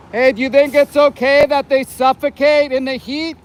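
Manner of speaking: shouting at the truck driver in the heat; yelling at the driver. Real, not acted. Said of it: "Sorry for the yelling".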